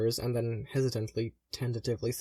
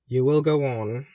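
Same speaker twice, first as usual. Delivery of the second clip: louder, firm reassurance; firm insistence; 7 dB louder than usual. Acted. Brother insisting his sister will go on, louder and firm. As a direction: insist she will survive.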